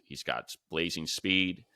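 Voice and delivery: ordinary delivery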